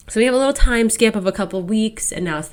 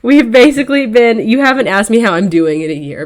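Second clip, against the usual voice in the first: louder, fed-up retort; exasperated rant; 3 semitones higher, 7 dB louder than usual. Retold or imitated. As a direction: snap back in frustration.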